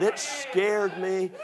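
very slow, stretched storyteller delivery; retold scare, stretched long; dramatically drawn out. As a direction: drag out "scared" for suspense. Retold scary story, dragged out.

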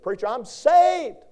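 high, loud, slow protest; hammed-up high loud I'm saved; mock congregant. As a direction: play a churchgoer insisting, drawn out. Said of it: "You said".

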